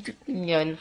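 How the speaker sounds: normal talking voice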